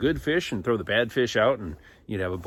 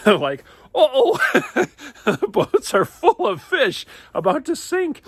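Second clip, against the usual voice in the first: high uh-oh broken by laughter; giggling at the overloaded boats; laughing; 9 semitones higher, 7 dB louder, slower than usual. A narrator's reading. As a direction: chuckle through the punchline.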